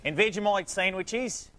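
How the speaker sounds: hammed-up high Australian announcer; bad Australian accent. Host welcoming an Australian guest onto the show, bad Australian accent. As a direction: lay on a terrible fake Australian accent. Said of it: "Was that the worst Australian accent".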